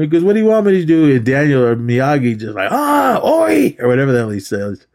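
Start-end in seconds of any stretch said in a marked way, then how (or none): 0.2-1.1 high-pitched helpless whine; hammed-up whiny question; mock whining. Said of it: "he's just pussying out". 2.7-3.8 very high mock cry; drawn-out high-pitched "ah, oi"; imitated exclamation.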